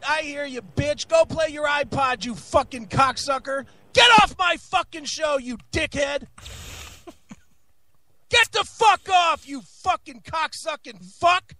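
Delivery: swearing tirade. Real, not acted. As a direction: shout every insult furiously. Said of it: "you know that was coming".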